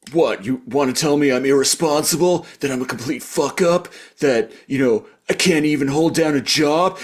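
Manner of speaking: hammed-up defensive rant; high, indignant string of questions; Grifter. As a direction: act out wounded, accusing self-pity. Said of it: "really projecting and putting words in his mouth".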